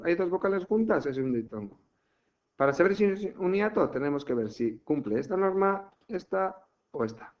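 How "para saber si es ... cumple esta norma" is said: usual speaking voice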